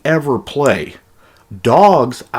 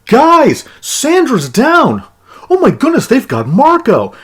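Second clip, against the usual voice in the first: high, shrill-pitched mock panic cries; mocking NPC panic; 13 semitones higher, 6 dB louder than usual. Gamer mocking enemy NPCs shouting about their fallen friends, high mock panic. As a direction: ham up a frantic guard calling out fallen comrades. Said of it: "they'll start shouting out names like".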